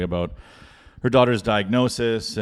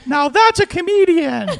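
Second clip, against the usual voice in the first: very high, loud imitation of fans; mosh-pit crowd yell; 5 dB louder than usual. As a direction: holler like an impressed festival crowd. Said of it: "I would hear people in this mosh pit going".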